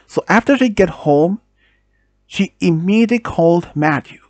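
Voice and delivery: ordinary delivery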